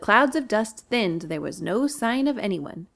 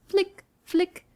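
high quick flicks; flick sound; 10 semitones higher than usual. Acted. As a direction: spatter the milk. Tail flicking sound effect.

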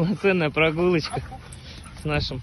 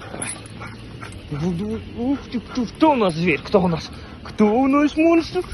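talking to a dog; 5 semitones higher than usual. Real, not acted. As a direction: coo at the puppy playfully. Baby talk to puppy.

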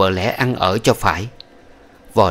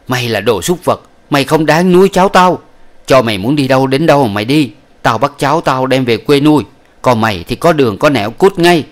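furious tirade; 4 semitones higher, 7 dB louder than usual. Acted. Old woman cursing concubine.

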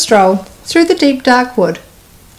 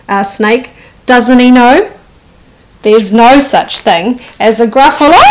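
plain reading voice

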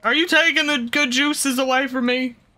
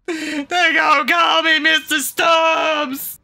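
louder, higher, slow outraged shout; 6 semitones higher, 5 dB louder, slower than usual. Real, not acted. Player wails about his new nickname, slow loud shout. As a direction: bellow the nickname in mock despair. Repeats line, then shouts it.

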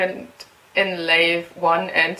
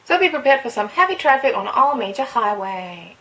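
bright, loud radio voice; reading a game's traffic report on air; radio host read; 4 semitones higher, 4 dB louder than usual. Acted. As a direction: read it like a morning DJ. Reads radio traffic update.

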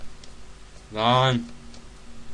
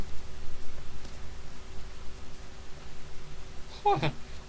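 high giggle. Real, not acted. Laughing at failed attempt.

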